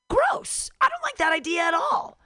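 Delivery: shrill cry of revulsion; disgusted outburst. Real, not acted. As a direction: yell it in disgust. Disgusted reaction.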